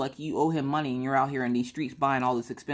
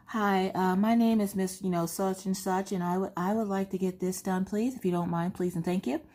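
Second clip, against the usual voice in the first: polite, higher-pitched phone voice; customer service voice; 4 semitones higher than usual. Acted. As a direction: put on your most courteous caller voice. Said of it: "get on the phone with customer service".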